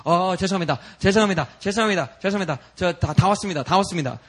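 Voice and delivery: repeated, hurried mock apology; startled waking girl. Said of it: "all of a sudden wakes up and says".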